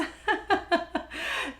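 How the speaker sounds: breathy, voiceless laughing; wry laugh. Real, not acted. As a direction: laugh it off after a grudging admission. Laughs at own half-hearted thankfulness.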